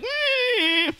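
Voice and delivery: very high, drawn-out whimper; wounded tiger whine. Acted. Gored tiger crying out, high pained whine. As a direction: squeal like a hurt animal. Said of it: "and tiger's like".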